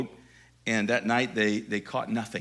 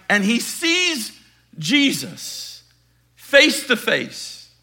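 louder and higher, rising to a peak; building preaching climax; 8 semitones higher, 12 dB louder than usual. Acted. Preacher to congregation, climactic fervent preaching. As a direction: build the moment he sees Jesus.

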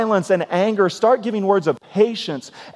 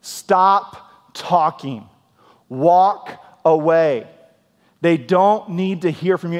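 slow, firm, louder commands; stern Holy Spirit; 3 dB louder, much slower than usual. Retold or imitated. The Spirit scolding a hard-headed believer, stern and slow. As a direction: lay down the law word by word. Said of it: "If you're a little more hard-headed".

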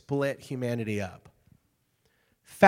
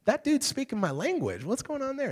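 amazed bystander; 11 semitones higher than usual. Retold or imitated. Amazed crowd at Pentecost.

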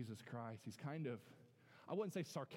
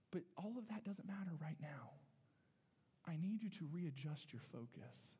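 whisper. Acted. Jesus gently correcting Martha.

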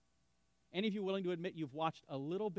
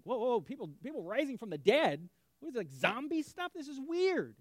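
raised, incredulous whoa about zombies; mock shocked listener; 5 semitones higher, 5 dB louder than usual. Skeptic to the pastor, mock shocked incredulity. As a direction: play a startled skeptic hearing of resurrection. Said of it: "You're like".

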